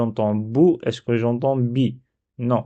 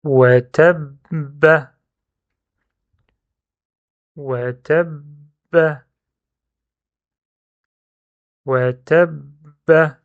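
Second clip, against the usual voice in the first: very slow, higher model reading; measured dictation with a long held double b; 4 semitones higher, 5 dB louder, much slower than usual. Shown as a demonstration. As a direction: dictate it drawn out, syllable by syllable. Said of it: "Deuxième mot".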